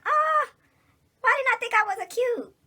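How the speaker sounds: high, loud mock wail; acted future self. Future self looking back at old videos, hammed-up regretful wail. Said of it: "five years later and be like".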